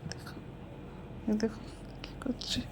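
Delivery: whispered so the pet sleeps on; hushed whisper beside a sleeping pet. Real, not acted.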